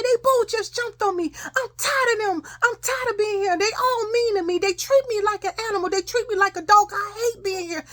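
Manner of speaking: high, wailing pile-up of grievances; reenacted distraught complaint. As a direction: pour it out like an upset child telling on them. Upset child complains to father.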